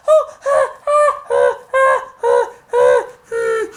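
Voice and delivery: high panicky "ah, ah", easing down; mock panting in fear; pretend frightened gasps.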